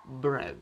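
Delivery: bread said "bred", short e; English bread. Shown as a demonstration. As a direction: say bread rhyming with red. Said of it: "lo pronunciamos bread". (bread pronunciado "bred")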